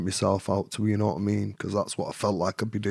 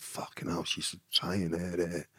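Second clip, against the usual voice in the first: whispered, slow, much softer; whispered thought; 4 semitones lower, 11 dB quieter, slower than usual. Retold or imitated. Man realises she is seducing him, whisper. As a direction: breathe the thought under your breath.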